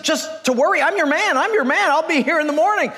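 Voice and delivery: high-pitched, excited yes; eager. Acted. New hire accepts huge salary, eagerly excited. Job seeker thrilled by the salary.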